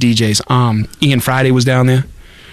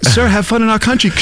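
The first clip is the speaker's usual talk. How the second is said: polite official greeting, pitched up; welcoming border agent; customs officer voice; 6 semitones higher than usual. Retold or imitated.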